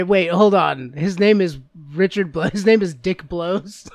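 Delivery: loud, stunned realization; sudden realization. Real, not acted. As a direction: say it as it dawns on you.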